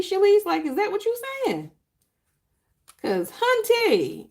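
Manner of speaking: sassy surprise. Real, not acted. Teasing praise to a viewer.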